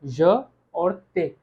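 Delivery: "य" and "ते" slowly separated; broken into syllables. Shown as a demonstration. Teacher breaking the word in two for students, slow syllable demo. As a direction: say "य" and "ते" as two distinct slow pieces. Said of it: "दो हिस्से में break हो रहा है".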